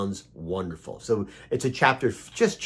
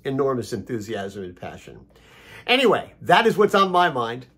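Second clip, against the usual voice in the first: normal talking voice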